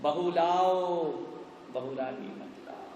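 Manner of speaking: ordinary delivery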